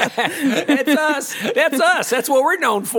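mock proud local. Mocking proud Southerners.

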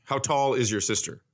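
ordinary delivery

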